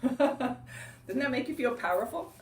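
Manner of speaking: ordinary talk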